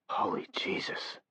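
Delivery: breathless whispered oath; hoarse whisper. A narrator's reading. Cameraman stunned by the reading, hoarse whisper. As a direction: barely breathe it out, slowly. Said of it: "in a hoarse voice".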